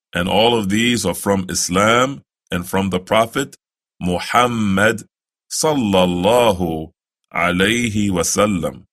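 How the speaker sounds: ordinary delivery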